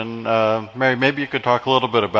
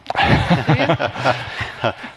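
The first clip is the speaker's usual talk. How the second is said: laugh; 4 semitones higher than usual. Real, not acted. Audience laughs at question.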